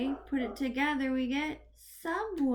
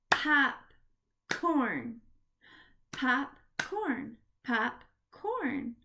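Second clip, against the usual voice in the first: popcorn split into "pop", pause, "corn"; a clear gap between pop and corn; syllable by syllable; much slower than usual. Shown as a demonstration. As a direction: clap out popcorn in two beats. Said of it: "we are gonna clap the syllable".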